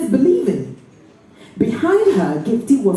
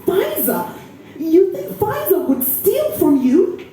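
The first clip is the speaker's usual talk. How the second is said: much higher, incredulous question; shocked disbelief; 9 semitones higher than usual. Acted. Niece defending her friend to the aunt, incredulous. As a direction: protest in disbelief.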